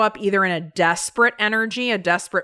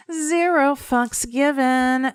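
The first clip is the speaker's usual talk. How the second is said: defiant sass; 7 semitones higher, slower than usual. Acted. Defiant punchline.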